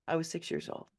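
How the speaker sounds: ordinary delivery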